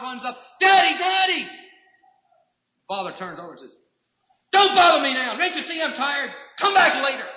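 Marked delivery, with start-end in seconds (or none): 0.6-1.5 put-on excited child. Child voiced in sermon story. 4.5-7.3 irritated father. Retold or imitated. Father voiced brushing off child.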